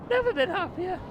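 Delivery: high, gushing repeat. Real, not acted. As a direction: gush the line up high with joy. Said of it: "I've never been happier".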